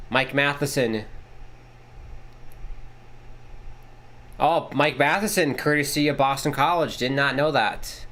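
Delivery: running broadcast call; play-by-play. Acted. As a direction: call the game like a TV announcer.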